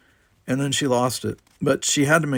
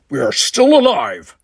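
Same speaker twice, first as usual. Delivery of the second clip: loud, forceful gritted delivery; grated; 13 semitones higher, 9 dB louder than usual. A narrator's reading. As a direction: grind it out to shake him. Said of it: "he grated".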